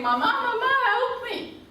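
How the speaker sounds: put-on high, loud, frightened child's scream; child screaming for help. Teacher acting the scared child on safari, high panicked scream. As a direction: shriek for mama like a terrified kid. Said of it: "the child starts to scream".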